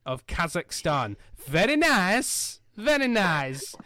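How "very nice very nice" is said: mock impressed. Mock praise of the team.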